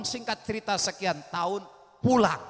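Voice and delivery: ordinary talk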